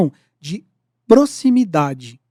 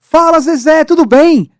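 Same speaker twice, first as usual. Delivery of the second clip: loud, high, booming greeting; big enthusiastic hail; enthusiasm; 8 semitones higher, 12 dB louder than usual. Shown as a demonstration. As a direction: burst out with an enthusiastic hello. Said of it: "é a entonação do entusiasmo".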